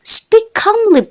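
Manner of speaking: "Speak calmly" said high and bright as a model sentence; model English phrase. Shown as a demonstration. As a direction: read "Speak calmly" out as a clear example for students. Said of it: "teman-teman bisa ucapkan seperti itu".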